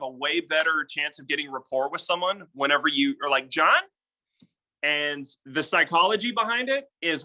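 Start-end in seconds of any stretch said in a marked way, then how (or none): 3.5-3.8 "John?" lifted at the end like a friend calling; rising "John?". Shown as a demonstration. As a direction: tilt "John" upward as a question. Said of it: "upward inflection in your voice".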